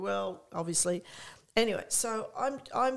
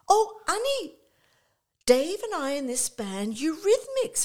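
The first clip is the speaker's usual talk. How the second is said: high, loud imitation of a friend; high-pitched mock excitement; gushing answer; 10 semitones higher, 6 dB louder than usual. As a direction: act out breezily sharing your news. Acting out the friend's breezy answer.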